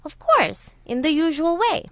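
high, exasperated retort; impatient tiger. A narrator's reading. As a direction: put out at having to explain the obvious. Impatient tiger answers slow jackal.

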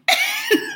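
short high-pitched laugh; amused little burst of laughter. Real, not acted. Recalling clerks asking for a moment, amused laugh.

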